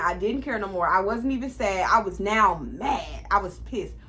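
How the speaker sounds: normal talking voice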